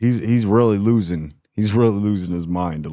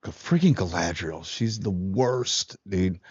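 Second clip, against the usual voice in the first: mock exasperated mutter; 5 dB quieter, slower than usual. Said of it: "She's like".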